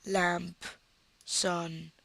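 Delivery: ordinary delivery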